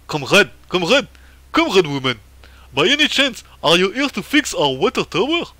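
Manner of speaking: loud, hammed-up Russian accent; badly faked Russian accent, pitch swooping up. Official asks comrade woman to fix the water tower, Russian accent. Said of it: "c'est plutôt un accent russe que je devrais prendre".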